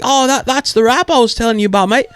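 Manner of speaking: high, chatty Australian impression; Australian accent. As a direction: imitate an Australian mate. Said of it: "he's the Australian guy".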